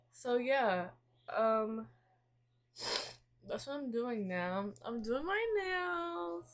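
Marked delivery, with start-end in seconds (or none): 4.8-6.5 high, slow sing-song with a lift on "my"; sing-song announcement. Real, not acted. To viewers while painting her nails, a high sing-song announcement. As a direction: announce "I'm doing my nails" brightly, stretching "my" up high.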